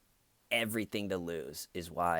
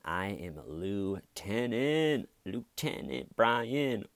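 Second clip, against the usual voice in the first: slow and smug, then a whooping cheer much higher and louder; smug confidence; 5 semitones higher, 8 dB louder, much slower than usual. Retold or imitated. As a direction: gloat like you're already free, ending in a big woo. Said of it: "from this confident".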